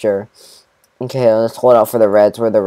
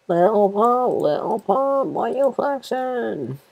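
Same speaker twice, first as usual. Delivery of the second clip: high, soft mocking singsong; high taunting voice; 10 semitones higher, 8 dB quieter, slower than usual. Gamer taunting an enemy player in a high mocking voice. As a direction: tease the enemy like a playground taunt.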